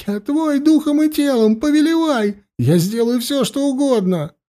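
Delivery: high, panicked shriek of a man losing control; terrified squeal. Acted. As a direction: squeal it out in terror. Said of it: "взвизгнул он, едва владея собой". (испуганный визг)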